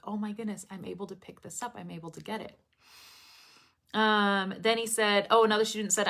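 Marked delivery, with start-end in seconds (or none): 0.0-2.6 quiet, quick imagined self-talk; hushed realization. Retold or imitated. Imitating students noticing sounds they can now catch, hushed realization. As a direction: murmur it as a softly amazed learner.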